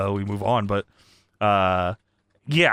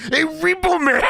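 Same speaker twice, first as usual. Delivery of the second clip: high hammed-up scream; imitated wrestler scream, drawn high; Macho Man; 12 semitones higher than usual. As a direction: holler a mock challenge at the camera. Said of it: "Macho Man's just screaming at the camera".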